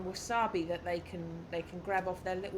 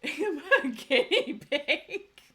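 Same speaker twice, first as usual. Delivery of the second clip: laughing question; 6 semitones higher, 9 dB louder, much slower than usual. Real, not acted. Laughing at her own idea.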